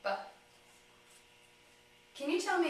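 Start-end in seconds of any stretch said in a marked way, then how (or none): 0.0-0.1 B as a brief buh; short sound. Shown as a demonstration. Clinician sounding out the start of bit, B as a quick buh. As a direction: let B pop once, a short buh. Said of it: "has a short sound".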